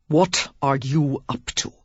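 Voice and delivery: slow, menacing question; murderous tone. A narrator's reading. The suspicious earl interrogates Lily, slow and menacing. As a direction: threaten her slowly, barely holding back rage. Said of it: "he asked in a murderous tone".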